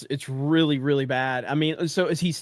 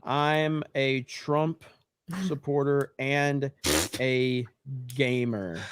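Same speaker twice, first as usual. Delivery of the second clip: very slow, word by word while typing; low, drawn-out dictation with pauses between words; dictating a tweet; 3 semitones lower, 4 dB quieter, much slower than usual. Real, not acted.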